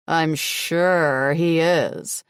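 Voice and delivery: very slow, dragged-out sarcasm; sarcastic. A narrator's reading. Captive mocking his claim about his son, slow sarcasm. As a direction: drip with disbelief, slowly. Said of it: "I say sarcastically".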